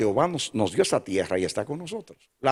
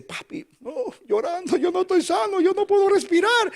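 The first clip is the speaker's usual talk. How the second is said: put-on high, crying child's voice, far higher. Son crying to his father at dawn, high tearful child voice. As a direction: imitate a sick son crying that he can't breathe. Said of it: "Y él dice, llorando".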